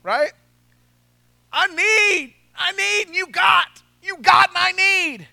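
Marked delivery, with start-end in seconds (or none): 1.5-5.3 high, loud, pushy mock beg; high loud imitation of someone who always wants more; whiny begging voice. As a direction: ham up a greedy asker. Mimicking someone begging for things.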